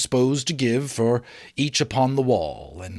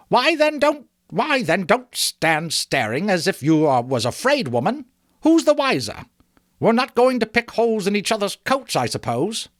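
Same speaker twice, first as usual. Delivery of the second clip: higher, louder bold challenge; defiant; 9 semitones higher, 3 dB louder than usual. Acted. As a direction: brush off their qualms. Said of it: "said the woman".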